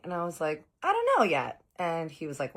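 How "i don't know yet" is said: coy sing-song. Retold or imitated. Coy reply to boyfriend.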